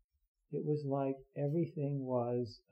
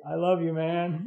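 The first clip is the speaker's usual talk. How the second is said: a little higher, laughing as he says it; heartfelt; 3 semitones higher, 8 dB louder than usual. Pretend. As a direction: warmly blurt it out to an old friend, half laughing. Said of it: "and just say".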